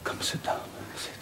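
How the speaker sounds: soft whispered begging; pleading whisper. Acted. Rochester begging Jane to hear him out, whisper. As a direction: beg her to stay, barely voiced.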